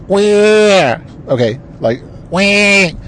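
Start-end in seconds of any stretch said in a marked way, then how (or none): 0.1-1.0 high, stretched-out "wah"; cartoonish complaining whine; mock Republican whine. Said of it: "They're saying". 2.3-2.9 high, stretched-out "wah"; cartoonish complaining whine; mock Republican whine. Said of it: "The Republicans are saying".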